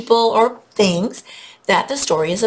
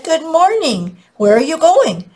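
friendly butcher voice; 9 semitones higher, 5 dB louder than usual. Acted. Butcher greets Jack in story.

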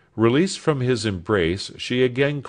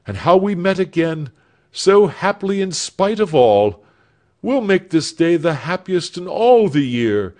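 put-on a young lover's voice, raised and louder; Alfred voiced with hopeful fervour; earnest young man; 8 semitones higher, 7 dB louder than usual. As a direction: promise the future with feeling. Alfred's happy farewell speech.

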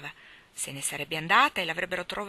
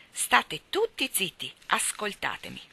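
higher, slow call for silence; child taking charge, raised pitch; bossy command; 3 semitones higher than usual. A narrator's reading. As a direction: order everyone to listen up.

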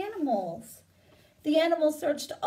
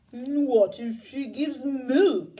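cow voice; 5 dB louder, much slower than usual. Acted. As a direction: speak like a worried old cow, dragging each word. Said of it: "asked the cow".

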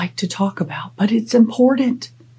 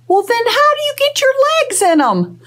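much higher joke voice; silly high punchline; joking. Pretend. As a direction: deliver the punchline in a goofy high voice. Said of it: "Just a little joke".

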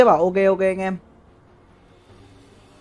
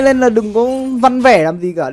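ordinary talk

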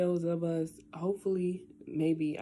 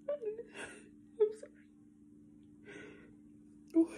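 real crying, voice breaking; breaking into tears; 7 dB quieter, much slower than usual. Real, not acted. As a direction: voice cracks high into a sob. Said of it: "Why am I crying so much?".

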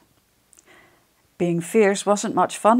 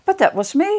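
much higher little squeak; squeaky mouse voice; 11 semitones higher, 4 dB louder than usual. Acted. Mouse admits to his friends, high squeak. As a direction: squeak it out, a bit sheepish. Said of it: "squeaked Little Grey Mouse".

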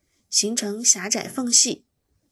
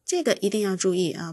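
ordinary talk

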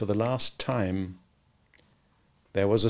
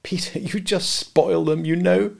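slow, higher coy wife; simpering; 7 semitones higher than usual. A narrator's reading. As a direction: simper it sweetly. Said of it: "Wendy simpered".

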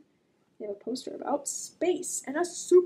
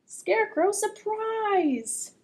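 excited announcement; 8 semitones higher, 5 dB louder, slower than usual. Acted. Scarecrow scares the birds.

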